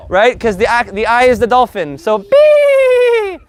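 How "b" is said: high, loud shouted B; exaggerated teacher shout. Shown as a demonstration. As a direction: bark out the letter B. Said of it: "V is always gonna be a B".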